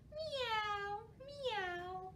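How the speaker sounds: high mewing meow; cat meow. Pretend. Librarian meows like a cat for children, high meow. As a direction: mew like a kitten.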